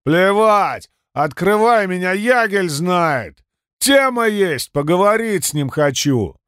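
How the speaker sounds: put-on high, slurring, pushy drunk; slurred drunk shout. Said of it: "изображая пьяного".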